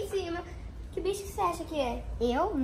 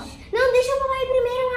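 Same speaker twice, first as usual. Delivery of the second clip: high, loud interruption; loud, high-pitched demand; insistent shouting; 7 semitones higher, 8 dB louder than usual. Real, not acted. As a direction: shout over the others to get in first. Insists on going first, interrupting.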